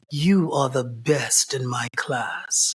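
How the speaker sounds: low, slow, measured pronouncement; imitated stern teacher. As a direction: play the intimidating teacher giving a deliberate verdict. Intimidating teacher's quote acted out.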